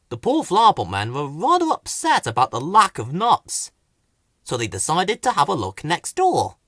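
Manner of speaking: ordinary delivery, nothing marked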